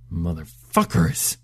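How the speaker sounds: low, slow muttered curse; bitter curse. Acted. Husband hears the rent is going up, slow low curse. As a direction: drag the swear out under the breath.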